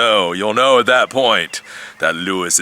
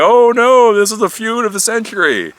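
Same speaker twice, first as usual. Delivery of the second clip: much higher, louder gasping mock panic; mock horror; 13 semitones higher, 5 dB louder than usual. Viewers horrified by the geek feud, hammed-up higher alarm. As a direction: ham up the shocked onlooker. Mocking horrified tech newcomers.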